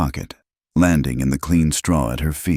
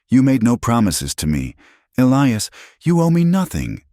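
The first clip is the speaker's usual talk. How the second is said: rising from raised to very high pitch; hurt rejection; 8 semitones higher than usual. A narrator's reading. Tearful woman telling him he owes nothing, angrily. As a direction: build hot and angry to the last word.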